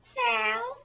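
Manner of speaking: very high, chirpy little voice; girl contestant. Acted. Contestant giving her name, high mock girlish voice. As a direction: squeak it out as a proud contestant. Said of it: "And she'd say".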